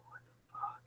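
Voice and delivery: unvoiced, amazed whisper; whispered disbelief. Player's character staring at the sword, whispered disbelief. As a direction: breathe it in awe. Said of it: "you're just like".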